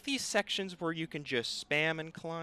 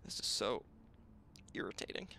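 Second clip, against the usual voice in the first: whispered frustration; 9 semitones lower, 13 dB quieter, faster than usual. Real, not acted. Frustrated aside under breath.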